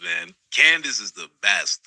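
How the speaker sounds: normal talking voice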